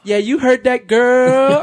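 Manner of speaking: loud, teasing shout-out to your wife; playful calling out. Pretend. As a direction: call out a cheesy dedication.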